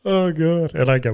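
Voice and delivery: usual speaking voice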